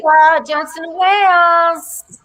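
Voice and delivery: put-on Rhode Island accent. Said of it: "I got my Rhode Island accent".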